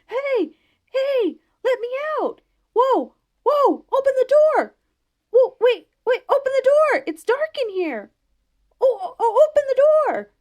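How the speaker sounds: very high, loud, panicked yelling to be let out; ghost hollering. A narrator's reading. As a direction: bang on the door with your voice, frantic and shrill with fear. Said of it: "the old ghost inside started hollering".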